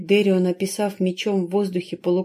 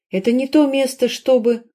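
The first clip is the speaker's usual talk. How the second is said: shouting; 5 semitones higher, 4 dB louder than usual. A narrator's reading. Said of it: "прокричала Кристалин".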